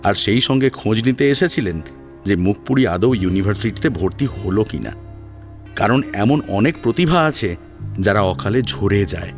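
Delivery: ordinary delivery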